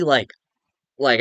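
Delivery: normal talking voice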